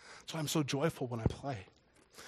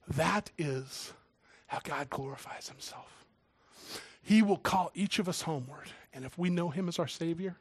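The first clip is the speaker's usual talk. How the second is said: ordinary talk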